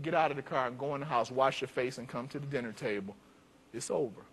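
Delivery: quiet, kind, it's finished now; gentle dismissal. Retold or imitated. Deacon softens, sends boy inside.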